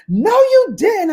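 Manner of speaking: put-on high, slow child's denial; imitating niece. Niece disbelieving.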